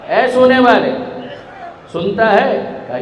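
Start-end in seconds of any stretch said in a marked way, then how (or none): 0.1-0.9 calling out louder. Acted. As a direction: call it out high and louder, reaching across a distance. (دور سوئے ہوئے کو اونچی آواز میں پکارنا)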